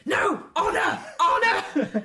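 high, loud indignant cries; loud battle-proud protest; defiant refusal. Acted. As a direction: shout it like a proud little warrior. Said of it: "He goes".

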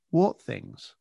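sudden calm late-night radio tone; midnight DJ voice. Retold or imitated. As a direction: pull the voice down to draw him out. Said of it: "I pulled the tone down".

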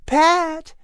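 put-on very high-pitched young bride; Sally's voice. Sally asks Patrick for another dance in a high voice. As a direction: put on a high feminine voice, asking sweetly. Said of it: "came Sally's voice behind him".

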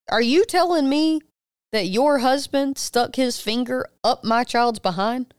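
acted disbelief, high and deliberate; incredulous confrontation. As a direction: spell out the accusation slowly, pitched high. Retold confrontation over the exam.

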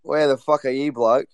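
over-the-top fake Australian banter; Australian accent. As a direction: ham up a mock Australian bloke. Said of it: "how do you do the Australian accent?".

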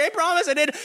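put-on high blubbering kid confession; sobbing kid. Kid confesses to mom, high mock sob. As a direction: blurt it through mock tears. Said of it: "it's like".

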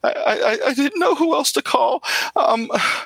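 high, stammering, near tears; frightened woman begging for help; shaking voice. Acted. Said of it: "why are you shaking".

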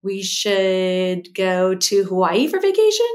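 statement lifted at the end like a question; upspeak. Shown as a demonstration. Host demonstrating upspeak to listeners, slow question-like rise. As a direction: say it slowly, ending on a questioning rise. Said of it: "when you end a statement like a question".

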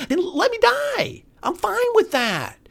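high, defiant cry; defiant outburst. Real, not acted. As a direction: fling it out defiantly.

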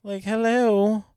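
drawn-out, high mocking "hello"; sarcastic hello. Real, not acted. Host mocking people who miss the obvious, sarcastically drawn out. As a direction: stretch it slowly, as if it's obvious.

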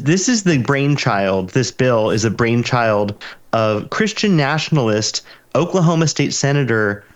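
ordinary talk

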